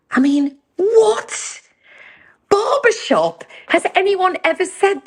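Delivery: can't believe anyone said that; stretched-out amazed what; incredulous. Real, not acted. Disbelief at the odd line.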